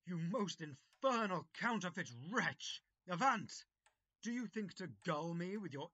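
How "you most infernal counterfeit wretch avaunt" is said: loud, outraged roar at the rogue; a shouted, scornful banishment; furious shout. Acted. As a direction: drive him out with a bellowing rebuke. Master drives the devil out.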